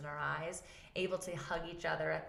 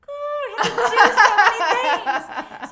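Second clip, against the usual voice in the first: much higher and louder, laughing; laughing overwhelm; 19 dB louder than usual. Retold or imitated. Mom overwhelmed at bedtime, loud laughing squeal. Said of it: "And I'm like".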